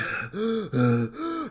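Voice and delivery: exaggerated mimed panting; heavy breathing. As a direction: puff hard like a winded messenger. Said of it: "And he's breathing heavy".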